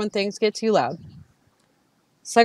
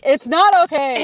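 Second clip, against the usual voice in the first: high, loud stress on not; retort; 7 semitones higher, 6 dB louder than usual. Retold or imitated. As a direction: snap back on not. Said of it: "I'm like".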